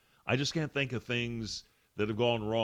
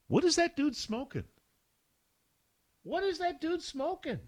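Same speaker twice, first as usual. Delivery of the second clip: mocking squeaky falsetto, much higher. Host mocking a critic, high squeaky falsetto. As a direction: ridicule him in a high squeaky voice.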